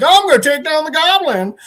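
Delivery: high, loud, goofy mimicry; mocking idiot voice. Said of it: "like the fucking idiot he is".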